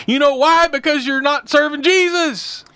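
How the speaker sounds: blunt retold challenge, pitched high; forceful re-enacted rebuke; up front. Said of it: "was up front".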